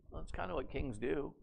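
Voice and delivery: ordinary delivery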